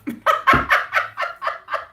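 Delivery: high, loud, breath-driven laughter; big laugh. Real, not acted. Reader laughing at her muddle over a name, high laughter. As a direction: crack up in a high rattling laugh.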